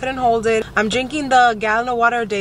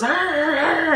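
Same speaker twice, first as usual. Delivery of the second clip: imitated puppy whine; 7 semitones higher, slower than usual. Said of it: "he goes".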